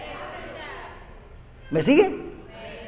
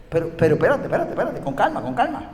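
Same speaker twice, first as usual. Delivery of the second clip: soft calming; 8 dB quieter, faster than usual. Acted. Calming voice answering the impatient.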